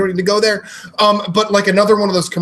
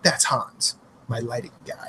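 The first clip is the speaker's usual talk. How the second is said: softer, hushed imitation of a Belgian pitchman; Belgian man; 6 semitones lower, 9 dB quieter than usual. Belgian man introducing his lighting guy, quiet put-on voice. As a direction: drop it low and quiet, mock introduction. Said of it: "and he's like".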